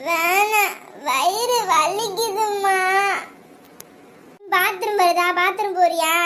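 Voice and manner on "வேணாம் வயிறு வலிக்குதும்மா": slow, drawn-out tummy-ache whine; crying. Acted. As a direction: moan tearfully to mother, stretching the words. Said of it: "ஏன் அழுகுறடா?". (வலியில் இழுத்து அழுதல்)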